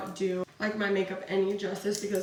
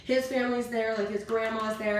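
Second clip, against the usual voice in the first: usual speaking voice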